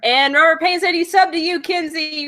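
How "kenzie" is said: normal talking voice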